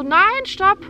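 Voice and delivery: high, loud call after the departing midwife; retold scream. Mother in labour calling the leaving midwife back, shouting. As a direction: yell for her to come back, panicked. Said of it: "ich schrei so".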